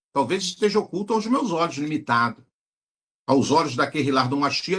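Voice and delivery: usual speaking voice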